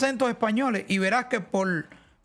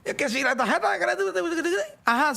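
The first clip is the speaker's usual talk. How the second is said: laughing; 7 semitones higher than usual. Retold or imitated. Mocking bank caller, laughing.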